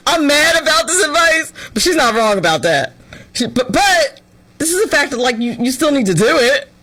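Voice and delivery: normal talking voice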